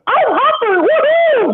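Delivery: very high, cartoonish chirpy voice; hammed-up high-pitched wolf voice; squeaky high voice. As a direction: squeak it out like a tiny excited kid. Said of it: "I would like to have the voice like".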